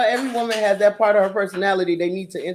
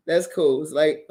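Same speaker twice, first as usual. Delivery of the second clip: ordinary delivery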